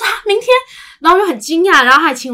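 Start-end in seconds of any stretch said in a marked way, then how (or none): 0.0-0.5 high startled "明天?" retelling the shock; retold surprise. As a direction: gasp out the word in mock surprise. Retold shock at closing hour. (惊讶地重复"明天？")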